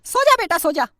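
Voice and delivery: soft lullaby coo. Acted. Mother lulls child to sleep.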